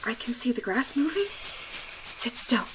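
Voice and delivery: frightened whisper. Acted. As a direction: hush it, terrified. Creature approaching behind them.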